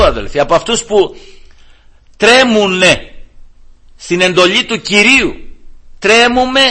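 high, forceful exhortation; impassioned preaching. Real, not acted. Preacher urges believers to tremble at God's command, impassioned preaching. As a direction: press each phrase on the congregation with mounting force.

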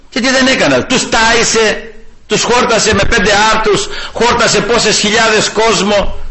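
fiery preaching, pitched high; preaching at full force. Acted. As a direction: rail against the unbelievers from the pulpit. Preaching Christ's deeds.

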